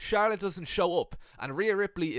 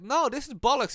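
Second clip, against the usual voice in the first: a much higher, louder furious no; angry protest; 8 semitones higher, 4 dB louder than usual. Retold or imitated. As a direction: bark it in outrage. Wrestler angrily refusing partner's excuse.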